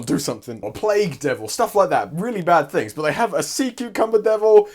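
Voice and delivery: usual speaking voice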